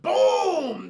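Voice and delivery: loud, drawn-out boom; imitated explosion. Narrating the missile going off, booming explosion noise. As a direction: boom it out like a blast.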